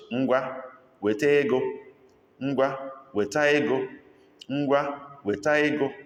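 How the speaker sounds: Ngwa, weta ego said high and firm, stress on weta; demo phrase. Shown as a demonstration. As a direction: push the word weta, demanding. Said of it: "How do we say that in Igbo language?".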